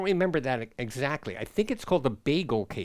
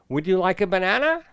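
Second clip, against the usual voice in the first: quicker, "banana" lifting at the end; rising question; 4 semitones higher, 4 dB louder, faster than usual. Shown as a demonstration. Teacher showing a question with a rising voice. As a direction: ask it with "banana" climbing high. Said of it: "our voices rise".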